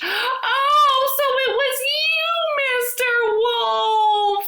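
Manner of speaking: mother hen screeching at the wolf. A narrator's reading. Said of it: "the chicken screeched".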